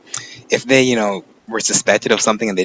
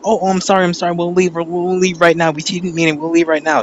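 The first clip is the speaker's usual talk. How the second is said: higher, hurried apology in imitation of the flustered mom; embarrassed mom apologizing; 6 semitones higher than usual. Mom apologizes to guard, imitated higher and flustered. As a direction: beg off quickly, sorry and wanting out. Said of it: "but she's like".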